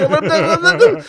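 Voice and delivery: high, loud sputtering buts; stammering protest. Retold or imitated. Friend trying to interrupt the narrator's yelling, high and loud. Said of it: "ואסף מסתכל".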